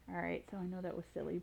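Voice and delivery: ordinary delivery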